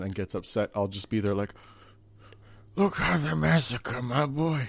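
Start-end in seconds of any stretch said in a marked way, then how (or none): 2.8-4.7 slow, soft, hammed-up grieving mobster; mock-mourn like the Godfather; Marlon Brando. Host imagining grieving over his dog in mock Brando voice. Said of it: "in character as Marlon Brando".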